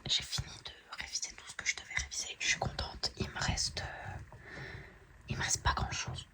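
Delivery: whispering so the family sleeps; hushed late-night whisper. Real, not acted. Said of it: "Je peux pas parler plus fort que ça".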